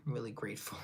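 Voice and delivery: unvoiced whisper of I'm really grateful. Real, not acted. Thanking viewers for ten subscribers, whispered. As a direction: breathe the thanks out softly.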